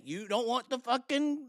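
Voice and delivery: normal talking voice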